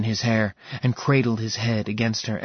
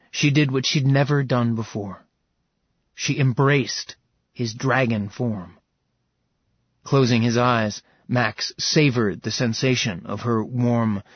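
plain reading voice

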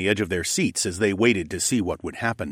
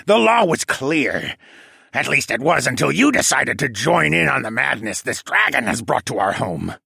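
penguin villain rant; 6 semitones higher, 4 dB louder than usual. A narrator's reading. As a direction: accuse them bitterly as a pinned penguin. Penguin villain ranting at captors.

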